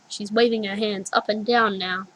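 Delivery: ordinary delivery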